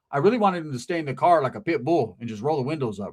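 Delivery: ordinary talk, nothing marked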